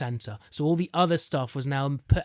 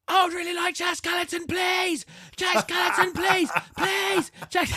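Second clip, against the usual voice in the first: high excited shout. Retold or imitated. Little boy begging Santa for his toy, shouting high. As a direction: shout the polite plea at the top of your voice like an excited child. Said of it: "he's shouting at the top of his voice".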